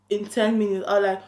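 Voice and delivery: normal talking voice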